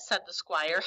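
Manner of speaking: usual speaking voice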